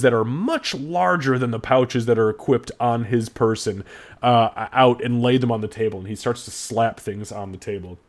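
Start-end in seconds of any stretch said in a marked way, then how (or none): none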